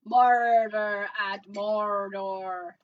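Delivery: put-on slow, drawn-out muddled accent with rolling R's; hammed-up mystery accent; indeterminate accent. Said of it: "don't even know what accent I'm doing".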